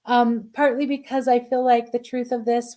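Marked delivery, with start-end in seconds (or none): none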